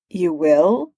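understated surprise, rising question; surprised. A narrator's reading. Doom hearing Tony surrender, mildly surprised. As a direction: let the question lift in mild disbelief. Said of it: "Doom sounded surprised".

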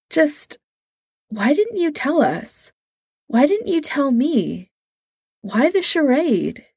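a friend asking why he was lied to; hurt plea. Acted. Steve pleads, hurt by secret.

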